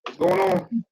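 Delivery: muffled mic sound. Real, not acted. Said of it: "You sound a little muffled there".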